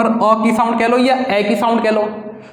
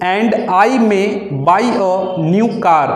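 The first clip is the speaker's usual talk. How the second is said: slow dictation; much slower than usual. Shown as a demonstration. Dictating shorthand exercise.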